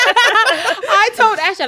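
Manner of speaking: high, loud and slow through laughter; laughing. Real, not acted. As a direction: talk through a real laugh. Restarts story after laughing fit.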